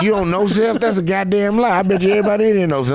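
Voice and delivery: hammed-up home invader barking orders; pretend menacing raid. Imagined raid on the family.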